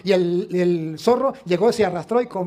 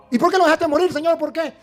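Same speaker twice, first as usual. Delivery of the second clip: más agudo y fuerte, indignado; le exige a Dios una respuesta; reclamo indignado; 7 semitones higher than usual. Acted. (el hombre increpa a Dios con indignación)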